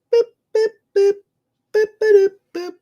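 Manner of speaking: short, very high beeps; imitated beeping. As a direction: beep like a little machine. Imitates beeping while reading comments.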